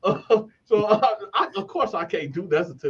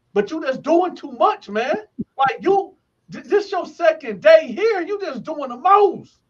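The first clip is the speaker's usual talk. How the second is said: high-pitched exasperation; retold exasperated scolding; 8 semitones higher than usual. Telling off a new cellmate on his second day, retold exasperation. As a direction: imitate fed-up protest, pitch riding high.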